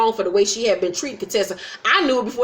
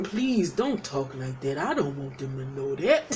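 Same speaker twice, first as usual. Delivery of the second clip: Damon; 7 semitones lower, 8 dB quieter than usual. Retold or imitated. Husband begging his wife not to talk like that, low soft impression. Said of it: "Love doing my Damon impression".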